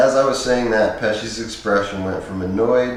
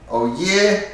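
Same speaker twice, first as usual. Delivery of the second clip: mildly cynical Pesci, doubting; a light sceptical scoff; a hint of a cynical tough guy; 9 semitones higher than usual. A narrator's reading. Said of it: "he said cynically".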